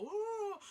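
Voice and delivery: high mock-romantic "woo"; sarcastic high-pitched swoon of wonder; drawn-out mocking whoop. Mocking the sudden gazebo.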